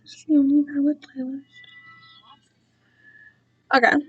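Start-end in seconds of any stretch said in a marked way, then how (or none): 0.0-1.4 혼잣말처럼 속삭이는 목소리; whispered aside. Real, not acted. Muttering about her playlist.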